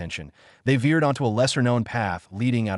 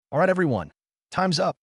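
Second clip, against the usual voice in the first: high, ringing call to the group; 8 semitones higher than usual. A narrator's reading. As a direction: call out across the field. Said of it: "Instructor Max called out".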